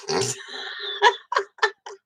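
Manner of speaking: high burst of laughter; real laugh at her own story. Real, not acted. Teller reaches the Sunday sermon punchline, laughing.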